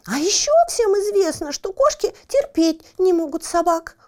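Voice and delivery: high, loud and outraged; indignant cat. Acted. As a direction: bristle with indignation. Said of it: "возмутилась Барбариска".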